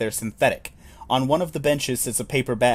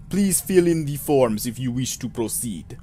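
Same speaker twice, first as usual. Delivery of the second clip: put-on Russian clerk voice; faux Russian accent. Clerk explaining procedure to visitor, faux Russian accent. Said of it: "a, faux Russian accent".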